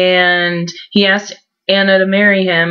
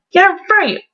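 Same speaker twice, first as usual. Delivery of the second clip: much higher sarcastic scoff; a high mocking yeah right; 11 semitones higher than usual. Real, not acted. Sarcastic dismissal of plot.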